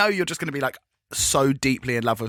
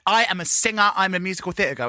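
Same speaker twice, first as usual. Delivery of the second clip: mock boast; 5 semitones higher than usual. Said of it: "She's like".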